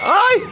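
high, loud "ay!"; mock scream. Acting out the push, high mock scream. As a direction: yelp as if shoved over. Cry as someone is pushed.

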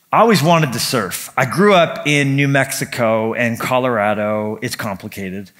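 normal talking voice

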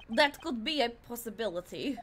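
ordinary delivery

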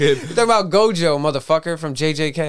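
loud mock-exasperated ribbing; exasperated outburst. Real, not acted. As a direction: burst out at your clueless friend. Exasperated correcting of co-host's wrong guesses.

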